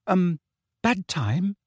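hesitant, high-pitched question; nervous. A narrator's reading. Girl intruding at a bad moment, nervously. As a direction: ask shyly, voice lifted. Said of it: "said a nervous voice".

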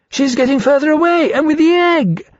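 very high, loud, urgent alarm; frantic cry. A narrator's reading. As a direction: cry out frantically as the thief escapes. Dragon frantic as thief escapes.

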